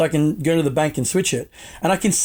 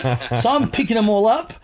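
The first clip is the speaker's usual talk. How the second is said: ordinary talk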